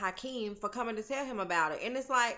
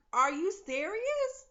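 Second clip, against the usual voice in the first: much higher, slow, stunned question; can't believe what you just heard; disbelief; 10 semitones higher, 4 dB louder, slower than usual. Real, not acted. To viewers about the crazy mama, high stunned disbelief. Reacting to the doll line.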